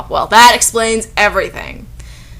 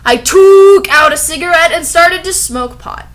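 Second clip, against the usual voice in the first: high, loud incredulous read; reading in outraged disbelief; 9 semitones higher, 9 dB louder than usual. Real, not acted. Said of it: "incredulous reading".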